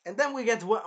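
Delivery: ordinary talk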